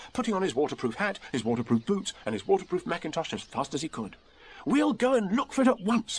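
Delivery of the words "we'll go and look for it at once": high-voiced boy calling to a friend; eager boyish call. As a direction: call it out briskly, pitched high. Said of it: "he called out to Eeyore".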